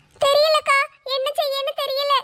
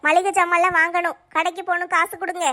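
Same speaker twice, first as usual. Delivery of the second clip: lower, nagging wife asking for grocery money; wife's voice; 8 semitones lower than usual. Acted. Wife asking husband for shopping money, nagging. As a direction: play the demanding wife.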